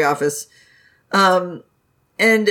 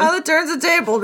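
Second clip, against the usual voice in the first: high voice; 9 semitones higher than usual. Pretend. Echoing the joke.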